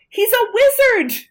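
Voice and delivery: very high, loud gushing; acted excitement. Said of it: "It's just so exciting".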